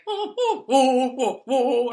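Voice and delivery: high, loud whooping through a laugh; imitated concert crowd. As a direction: mimic a distant crowd whooping. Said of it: "it's like".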